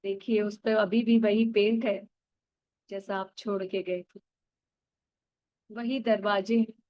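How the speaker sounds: put-on calm guide drawing the listener back to childhood; guided meditation. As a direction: lead them gently into the memory. Guides inner-child visualization.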